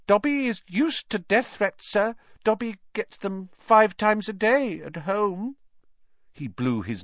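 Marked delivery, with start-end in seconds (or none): 0.1-5.6 high, squeaky elf voice; high-pitched humble little creature; meek house-elf. A narrator's reading. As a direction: squeak it meekly, matter-of-fact.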